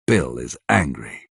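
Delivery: ordinary delivery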